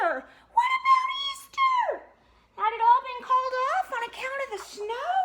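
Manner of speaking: high, loud dismayed cry; alarmed question. A narrator's reading. Storyteller cries out over lost Easter, high and fast.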